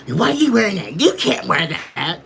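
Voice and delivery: wag a finger like a self-appointed authority; mock scolding busybody. Mimicking a woman telling a grown man what to wear, bossy. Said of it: "authority to tell some grown man".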